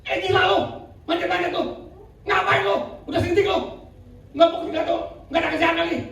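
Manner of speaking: high mocking heckler's taunt; jeering crowd. Onlookers taunting the climbers, high mocking jeer. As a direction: jeer at them as if they're mad. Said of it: "Mereka dibilang gila nggak".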